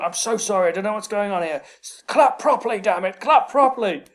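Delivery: a hammed-up headteacher begging the hall to clap properly; flustered teacher. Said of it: "this teacher was going".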